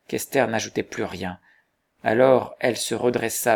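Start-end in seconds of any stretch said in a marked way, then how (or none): none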